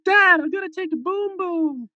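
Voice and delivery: high-pitched mock kid's call; six-year-old son. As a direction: imitate a little boy calling out to his dad. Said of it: "his six-year-old son ... would look at us all and be like".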